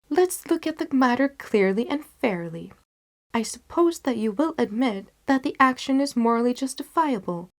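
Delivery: Holmes reasoning calmly. Acted. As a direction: talk a worried friend round. Holmes voiced in story.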